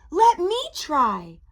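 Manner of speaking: cocky storybook ghost; boastful challenge. A narrator's reading. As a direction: step up boldly, drawing it out. Boasting ghost takes his turn.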